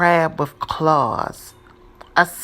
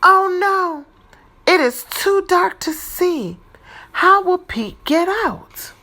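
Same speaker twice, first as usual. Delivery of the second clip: high, louder worried voice; mock worry; 12 semitones higher, 4 dB louder than usual. A narrator's reading. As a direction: raise it high with storybook alarm. Trapped in dark cave.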